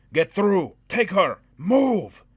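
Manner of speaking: loud high barked commands; urgent shouted orders. A narrator's reading. Dwarf ordering Carl through the gate, shouting. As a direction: yell the orders, no time left.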